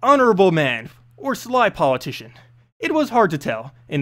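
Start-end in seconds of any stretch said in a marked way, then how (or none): none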